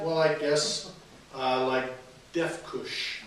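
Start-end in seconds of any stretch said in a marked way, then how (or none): 1.7-3.2 a tentative first reading of deaf kush; slow, careful sounding out of deaf kush. Shown as a demonstration. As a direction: say deaf kush slowly, syllable by syllable, with the weight on deaf. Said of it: "how would you pronounce that?".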